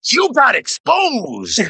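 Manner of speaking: high, drawn-out gleeful jeer; laughing taunt. Real, not acted. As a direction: crow it slowly through laughter. Laughing taunt at exposed streamer.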